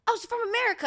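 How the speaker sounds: high-pitched mock Scottish accent; badly faked Scottish accent. American retelling Scots spotting her, badly faked Scottish accent. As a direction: imitate a curious Scot, pitched high. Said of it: "don't even know how to do their accent".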